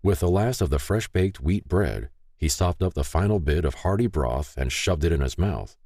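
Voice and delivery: ordinary delivery, nothing marked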